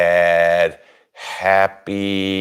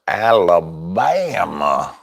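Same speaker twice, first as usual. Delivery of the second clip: drawn-out Forrest Gump Alabama; slow swoop up and down on Alabama; 3 semitones lower, much slower than usual. Retold or imitated. Host greeting a viewer from Alabama, mock Forrest Gump. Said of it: "Isn't that a Forrest Gump thing?".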